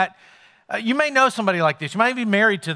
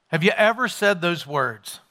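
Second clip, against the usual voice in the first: normal talking voice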